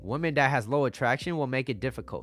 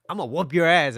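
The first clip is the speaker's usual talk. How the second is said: imitating mom yelling; 4 semitones higher, 8 dB louder than usual. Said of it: "She was like".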